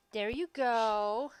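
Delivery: loud, slow, drawn-out approval; dog praise. Real, not acted. Owner rewards her dog for lying down, drawn-out praise. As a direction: stretch the words in pleased pet talk.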